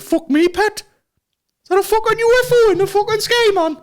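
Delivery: high-pitched, hammed-up Geordie; Geordie accent. Geordie pilot spotting a UFO, hammed-up Geordie accent. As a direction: put on an astonished high Geordie voice. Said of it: "Geordie, you know what I mean?".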